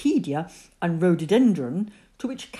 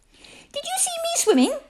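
very high, eager little voice; excited squeak. A narrator's reading. Roo asks after climbing out, excited squeaking. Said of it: "squeaked Roo excitedly".

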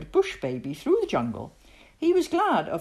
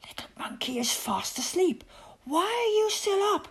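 high-pitched hushed whisper; urgent whisper; 12 semitones higher than usual. A narrator's reading. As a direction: whisper so the sleeping baby won't wake. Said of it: "and whispered".

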